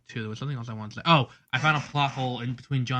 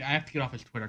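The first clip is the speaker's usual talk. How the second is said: ordinary talk, nothing marked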